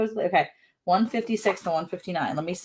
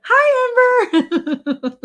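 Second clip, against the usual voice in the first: very high, loud sing-out hello; loud high-pitched call; squealed greeting; 9 dB louder, much slower than usual. Real, not acted. Calls out to viewer's child.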